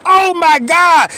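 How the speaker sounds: ordinary talk, nothing marked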